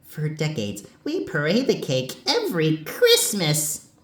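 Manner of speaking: high-pitched cartoon quote, peaking on Christmas; excitable high cartoon character voice; Double D impression. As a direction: imitate Double D, pitch swooping up. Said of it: "once Double D said".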